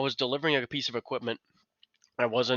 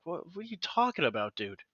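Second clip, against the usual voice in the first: retold disbelief; 6 semitones higher than usual. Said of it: "and I'm like".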